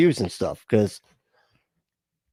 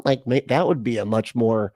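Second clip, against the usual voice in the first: usual speaking voice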